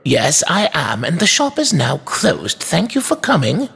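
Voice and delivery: put-on high, polite but firm old shopkeeper; curt toy maker. A narrator's reading. Toy maker closes shop to inspector, curt. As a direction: send him off in a high-pitched shopkeeper voice.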